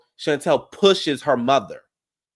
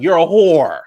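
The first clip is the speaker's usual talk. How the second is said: a loud, higher insult shouted out; yelled insult; 5 semitones higher, 11 dB louder, slower than usual. Retold or imitated. Jackie yelling at Evelyn, loud and high. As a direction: yell it at her. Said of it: "She was like".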